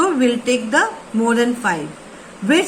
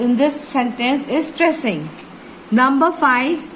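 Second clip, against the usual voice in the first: usual speaking voice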